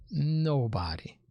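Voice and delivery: slow, breathy whisper of Nobody; whispered Nobody. to let it sink in; dramatic whisper. Acted. Dramatic closing echo, whispered.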